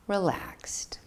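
soft whispered relaxed; whispered word. Shown as a demonstration. Teacher whispering the word on the exhale. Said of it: "if you say safe".

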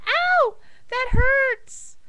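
high-pitched mock ow; hammed-up cry of pain; pained yelp. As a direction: wail it high, playing hurt. Joke patient touches sore spot.

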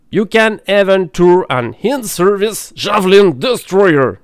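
over-the-top English accent read; mock English accent. Shown as a demonstration. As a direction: put on an exaggerated English accent. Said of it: "Merci pour mon superbe accent".

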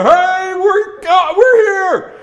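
very high, loud dog voice; high cartoon dog, calling out proudly; excited dog. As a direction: holler a keen arrival as a dog. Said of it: "He said".

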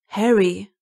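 narrated shock, pushed out in one burst; appalled. A narrator's reading. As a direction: say the name aghast. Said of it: "sagte Remus entsetzt".

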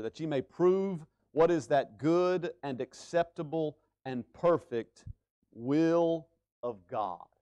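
drawn-out reading; slow scripture reading. Shown as a demonstration. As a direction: stretch out every word.

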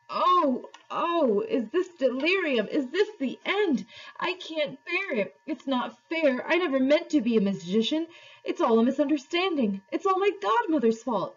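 high, wailing lament; hammed-up panicky whining; Uncle Andrew. A narrator's reading. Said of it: "it was wailing on".

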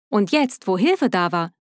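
plain reading voice

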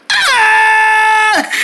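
high loud panicked scream; cartoon horror scream. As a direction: shriek at the awful discovery. Realizes he is a thief.

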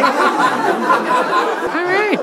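high, laughing "all right"; a stunned giggle through the words; laughing disbelief. Retold or imitated. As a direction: laugh it off in shock. Stunned reaction to grandma's insult.

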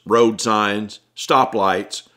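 usual speaking voice

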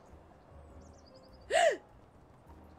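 high shocked gasp; startled gasp; loud gasp. Real, not acted. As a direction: gasp in surprise. Big gasp.

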